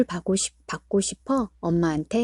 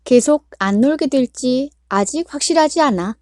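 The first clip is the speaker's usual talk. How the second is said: ordinary delivery, nothing marked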